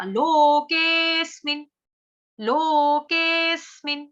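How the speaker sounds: "लोकेस्मिन्" slow, loud, hit on स्मि; stressed half s. Shown as a demonstration. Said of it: "आघात देख रहे हो". ("लोकेस्मिन्" में आधे स पर आघात, धीरे)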